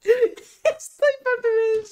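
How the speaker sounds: very high, drawn-out squeal; words dissolving into a tearful laugh; helpless laughter. Real, not acted. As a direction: squeal it through a laughing fit.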